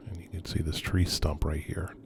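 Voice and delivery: hushed whisper while exploring; nervous night vlog whisper. Real, not acted.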